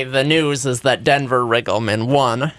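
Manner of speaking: usual speaking voice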